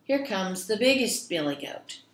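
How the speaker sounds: ordinary delivery, nothing marked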